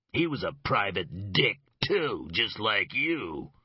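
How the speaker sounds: a sly thug gloating at the detective; sneering Leger. Acted. Leger taunts with low cunning.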